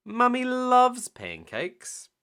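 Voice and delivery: high, loud, drawn-out delight; cheering. A narrator's reading. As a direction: keep cheering, stretched out and high. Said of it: "cheered Peppa".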